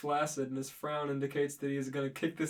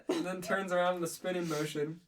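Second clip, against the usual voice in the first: ordinary delivery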